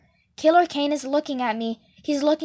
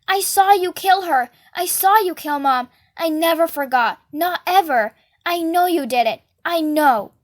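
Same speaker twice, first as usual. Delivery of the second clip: loud, raised accusing shouts; accusing yell; 3 semitones higher, 4 dB louder than usual. Acted. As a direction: hurl the accusation at him, desperate. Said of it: "I'm going".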